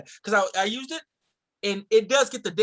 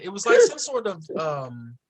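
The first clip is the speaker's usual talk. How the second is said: ordinary talk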